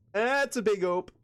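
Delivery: playful Michigan twang on "ope"; drawn-out Michigan ope. Shown as a demonstration. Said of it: "another thing Michiganders say".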